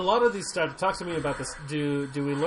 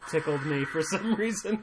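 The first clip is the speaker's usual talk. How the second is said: normal talking voice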